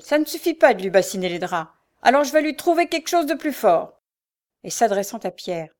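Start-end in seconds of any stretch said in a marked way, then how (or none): 0.0-4.0 loud threat rising higher; enraged. A narrator's reading. Frochard seeks stronger means to wake the girl, enraged. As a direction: build angrily into a loud threat. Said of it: "à la grande rage de la Frochard".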